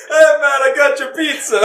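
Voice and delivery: high, shouted mock Jamaican accent; fake Jamaican accent. Retelling the aquarium pizza guy to friends, fake Jamaican accent. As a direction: imitate a pizza guy's hammed-up Jamaican accent. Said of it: "putting on a really fake Jamaican accent".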